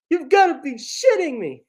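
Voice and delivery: high, loud incredulous exclamation; squealed "you can't be serious" reaction; amused disbelief. Real, not acted. Reacting in disbelief to casting news.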